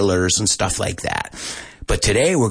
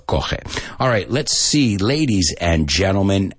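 ordinary talk

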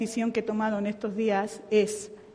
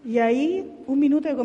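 normal talking voice

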